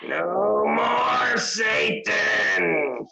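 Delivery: high, crying shout of defiance; possessed cry. Pretend. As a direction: scream it out possessed. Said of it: "That was so weird and possessed".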